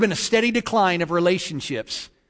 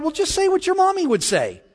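high, singsong mom voice, lightly acted; coaxing mother; 12 semitones higher than usual. Mother prompts daughter to say the blessing, lightly voiced coaxing mom. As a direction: coax the child in a high mommy voice. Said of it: "She replied".

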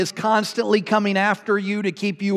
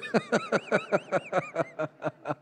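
laughing; 9 dB quieter than usual. Real, not acted. Laughing at own joke.

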